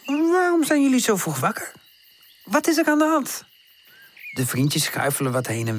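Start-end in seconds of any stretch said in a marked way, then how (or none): 0.1-3.4 tante's voice. Acted. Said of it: "zegt tante".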